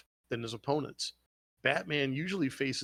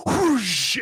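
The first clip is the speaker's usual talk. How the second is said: loud, high rushing whoosh; whoosh sound effect; 10 semitones higher, 10 dB louder than usual. Retold or imitated. Retelling the scroll attack, loud whoosh effect. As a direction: blast out the scroll's rush of water.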